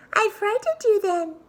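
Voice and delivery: very high, triumphant pig voice; gloating little pig. Acted. Little pig laughing at the scared wolf, high and gloating. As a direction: crow at the wolf.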